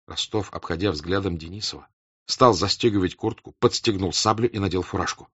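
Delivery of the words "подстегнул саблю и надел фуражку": plain reading voice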